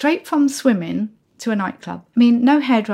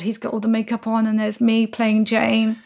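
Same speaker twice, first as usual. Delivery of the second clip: usual speaking voice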